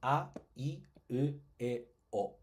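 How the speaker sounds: each vowel "A, I, U, E, O" said separately and clearly; teacher listing sounds; reciting vowels. Shown as a demonstration. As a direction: spell out the vowels one by one. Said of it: "Tienen cinco vocales".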